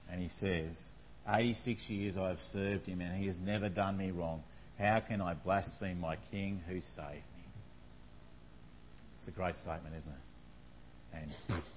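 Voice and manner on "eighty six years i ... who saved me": softer, low and steady vow; quiet resolve. Retold or imitated. Polycarp answers the Romans, sword at throat, quietly firm. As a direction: hush it, firm under threat.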